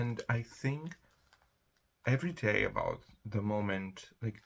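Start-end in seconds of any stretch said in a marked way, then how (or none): none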